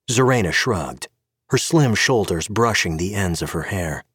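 plain reading voice

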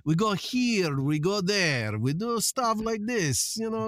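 comic Russian-accented English. Shown as a demonstration. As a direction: put on a playful thick Russian accent. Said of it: "can we get a sample".

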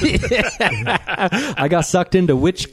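high, slow, real laughing speech; laughing admission. Real, not acted. Host teased about merchandising owns up, laughing. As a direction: own up to it through laughter.

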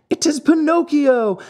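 high, loud joyful yell; excited yell. A narrator's reading. The marionettes pour out of the wings, yelling high and loud. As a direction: call his name out in delight. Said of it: "yelled all the marionettes".

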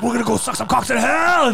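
excited demons. Retold or imitated. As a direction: play the giddy dumb demons cheering. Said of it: "They're all, like, excited".

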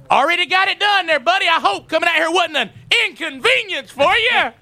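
husband mocks the installer who wasted his Saturday; sarcastic mock sweetness. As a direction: rub it in with fake politeness. Mocking the late installer.

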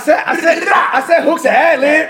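high, drawn-out protest through laughter; laughing insistence. Real, not acted. Defending the rapper against mocking co-hosts, laughing insistence. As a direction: insist while cracking up.

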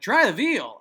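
loud, blurted punchline; catchphrase delivery. Retold or imitated. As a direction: cheerfully blurt it out of context. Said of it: "use it completely out of context".

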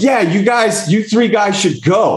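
high, light mock wife voice; imitating the wives. Quoting the wives sending the guys out, high mock voice. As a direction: chirp it like the wives urging them on. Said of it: "the wives were like".

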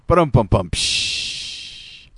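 mouthed drum fill ending in a hissed cymbal; spoken drum hit and cymbal crash; vocal rimshot. Pretend. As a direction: do a joke-punchline drum sting. Mouthed rimshot after a joke.

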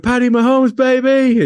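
loud, high, whooping holler; fan shout. Retold or imitated. Fan hailing a lady in a jersey, loud whooping shout. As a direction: holler it at a stranger like a superfan.

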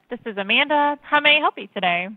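ordinary talk

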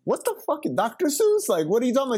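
high-pitched retold disbelief; baffled reply. Customer confused by Wendy's girl's word, baffled. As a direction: act out being totally thrown by the word.

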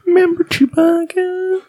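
very high, slow cutesy voice; Member Berries voice. Retold or imitated. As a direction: coo it high and slow like a cartoon berry. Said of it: "it was more member berries".

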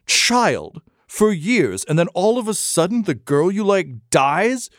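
halting disbelief rising into a high-pitched rant; appalled, scornful Duke. Acted. Duke berating the would-be reformer, scornful outrage.

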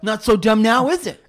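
mock the football players, puffed up and loud; loud bragging jock impression; jock bro voice.

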